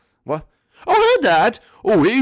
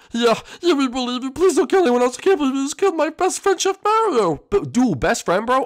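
put-on high, loud begging kid voice; pleading scared kid; 12 semitones higher, 5 dB louder than usual. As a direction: beg for mercy like a terrified kid.

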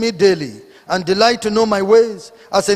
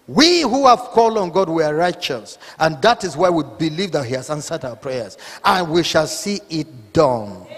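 ordinary talk

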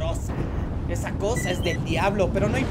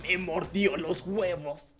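slow, low voice of defeat; mock pained lament; 3 semitones lower, 3 dB quieter, slower than usual. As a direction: groan it slowly, in mock agony. Bitten in game, pained aftermath. (lamento lento y adolorido)

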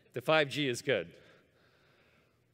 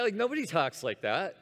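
normal talking voice

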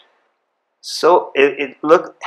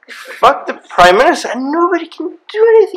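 much higher, almost squealing in disbelief; amazed excitement; 4 dB louder than usual. Real, not acted. Telling the host about Canada's free speech, amazed and excited. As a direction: let the wonder run away with your voice.

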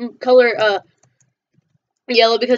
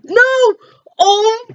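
dismayed cry; 7 semitones higher, 3 dB louder than usual. Real, not acted.

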